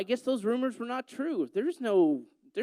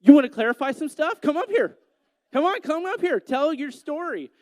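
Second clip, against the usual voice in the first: calling out; 6 semitones higher, 7 dB louder than usual. Real, not acted. Calling the girl up front.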